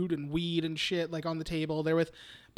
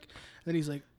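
ordinary talk

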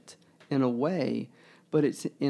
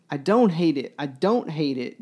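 emphatic outcry; 3 semitones higher, 6 dB louder than usual. Acted. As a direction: push it out like a denial that protests too much. Quoting Quentin's outburst.

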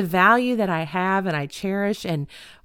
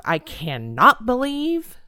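"cannot" stretched and punched, "believe" rising at the end; slow, incredulous "cannot", lifting into a question on "believe"; stress on CANNOT; 3 semitones higher, 4 dB louder, slower than usual. Retold or imitated. Re-enacting the rant from the other day, stress on "cannot". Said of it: "I said it every conceivable way".

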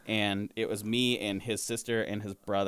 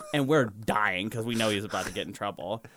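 ordinary delivery

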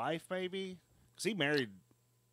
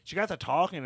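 usual speaking voice

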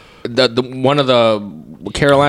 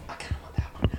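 whispering; 15 dB quieter, much faster than usual. Real, not acted. Quiet aside choosing a chip.

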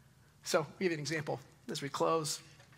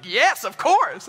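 high, loud, slow drawn-out yes of course; emphatic yes; 9 semitones higher, 15 dB louder, much slower than usual. Retold or imitated. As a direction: stretch out an obvious yes, much louder and higher. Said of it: "And Sarah's like".

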